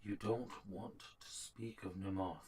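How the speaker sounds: hushed, confidential warning; whisper. Acted. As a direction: whisper it low, as a secret. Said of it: "and, and whispers".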